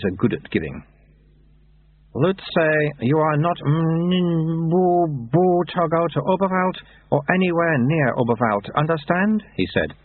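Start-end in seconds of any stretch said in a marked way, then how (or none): 2.1-9.4 put-on high, childlike abbot voice; baby abbot. A narrator's reading. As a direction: speak like a toddler with an old man's words.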